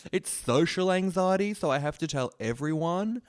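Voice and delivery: high, slow mocking whine; mock them as self-important; high slow sarcastic whine; mock whiny overshare.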